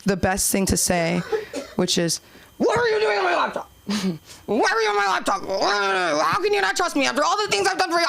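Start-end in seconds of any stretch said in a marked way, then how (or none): 2.6-8.1 mock defensive outrage. Teen snapping back at mom.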